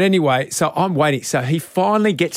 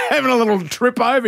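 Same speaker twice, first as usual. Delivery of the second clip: usual speaking voice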